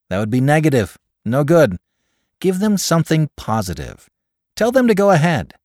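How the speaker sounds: high, loud, upbeat counsel; pleasant advice. A narrator's reading. Caller advises his friend to go ahead, pleasantly enough. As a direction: advise him firmly but pleasantly, voice lifted. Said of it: "advised Cross, pleasantly enough".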